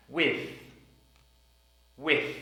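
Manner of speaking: With ending in a th; With, tongue out at the end; careful final th. Shown as a demonstration. Teacher drilling the word With. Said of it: "T H sound at the end of the word".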